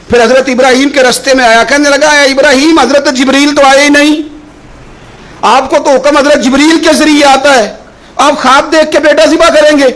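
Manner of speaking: high-pitched, insistent preacher delivery; impassioned preaching. Acted. Preacher retells Ibrahim's trial to the congregation, impassioned.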